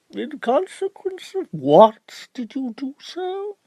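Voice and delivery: milk the question for effect; pompous barrister. Acted.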